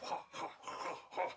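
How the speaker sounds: breathy imitated martial-arts puffs; mock Kung Fu cries. Showing off Kung Fu, breathy mock strike sounds. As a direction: huff out pretend fighting noises.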